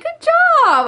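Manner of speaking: playful imitation of a friend cheering; friend's mock praise. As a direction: voice the friend in a silly high tone. Friend's reply voiced in story.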